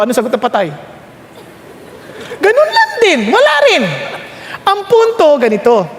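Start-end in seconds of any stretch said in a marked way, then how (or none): 2.4-3.8 pitched high and punchy; emphatic high answer. Real, not acted. Preacher drives home the point to the crowd, high and emphatic. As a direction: ring it out as the obvious answer.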